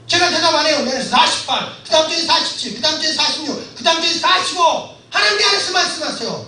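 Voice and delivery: normal talking voice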